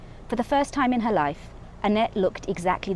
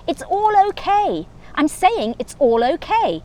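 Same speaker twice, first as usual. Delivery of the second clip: high, slow, sweetly forgiving; a high, slow breath of false calm; airy forgiveness; 8 semitones higher, 4 dB louder than usual. Acted. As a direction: lift it up and float the words. Annette calmly offering forgiveness.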